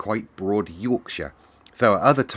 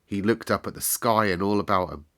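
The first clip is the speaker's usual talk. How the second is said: ordinary delivery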